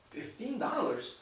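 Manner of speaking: slow, hushed disbelief; incredulous. Retold or imitated. Driver reacting to the parking sign, incredulous. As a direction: question the price in acted disbelief, softly and slowly.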